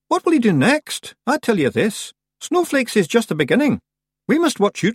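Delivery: high, broad Scots Feegle voice; a wee fierce Scots pixie, worried; Scottish Feegle. A narrator's reading. Rob warns the Kelda's friend, high Scots Feegle voice.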